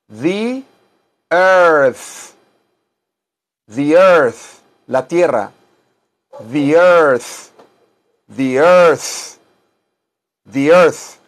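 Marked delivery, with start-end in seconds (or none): 0.1-4.6 "earth" ending in a long airy TH; emphatic model of "earth" with TH; airy final TH. Shown as a demonstration. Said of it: "hay que echar aire". 6.5-11.2 emphatic "earth" with long TH; "the earth" modelled with tongue-between-teeth TH; airy final TH. Shown as a demonstration. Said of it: "hay que echar aire".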